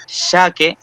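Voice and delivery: "Xaque" opened with an English SH; sh sound. Shown as a demonstration. Teacher reading out the Guaraní word, SH sound in "Xaque". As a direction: say "Xaque" starting with a hushing SH. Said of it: "igual que también la S H del inglés".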